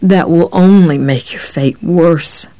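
slow, tired warning; weary. A narrator's reading. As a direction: warn with tired menace. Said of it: "the cop said wearily".

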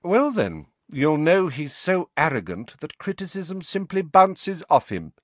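smooth, lightly snide; sleek. A narrator's reading. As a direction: Snape taunting Sirius sleekly. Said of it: "Snape said sleekly".

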